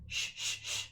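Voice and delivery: whispered hushing; urgent shushing. A narrator's reading. Grump begging the others to be quiet, whispered shush. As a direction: hush them, begging. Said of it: "begged Grump".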